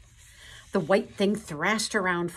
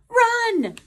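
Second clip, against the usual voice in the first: very high, loud panicked squeal; Piglet's squeak; 11 dB louder than usual. A narrator's reading. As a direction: squeal the warning in fright. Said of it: "squeaked Piglet".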